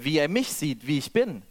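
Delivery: ordinary delivery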